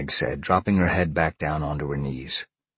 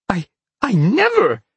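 put-on high, loud, stammered girl's gasp; shocked gasp; 8 dB louder than usual. A narrator's reading. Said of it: "Peg gasped".

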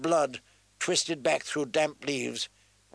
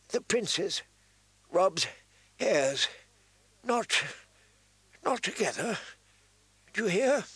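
halting, high, gasping dying man; weak, dying voice; 6 semitones higher than usual. A narrator's reading. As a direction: force out each phrase with failing breath. Dying maester's last instructions.

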